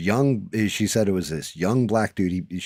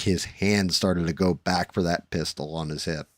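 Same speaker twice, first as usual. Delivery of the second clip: ordinary delivery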